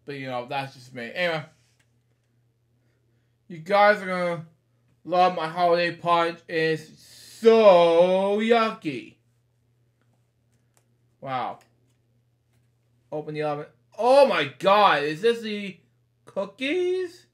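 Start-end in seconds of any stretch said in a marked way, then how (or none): 3.5-9.1 louder, gruff mock grouch with a slow drawn-out yucky; Oscar voice. Reading Oscar's punch line, hammed-up grouchy. 14.0-17.2 surprised. Real, not acted. Surprise at book flap.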